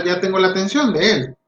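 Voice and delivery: normal talking voice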